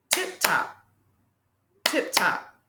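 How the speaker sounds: "Tip top" in two short, separate beats; clapped-out syllables. Shown as a demonstration. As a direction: chop "Tip top" to the rhythm of claps. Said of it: "can you clap out the word".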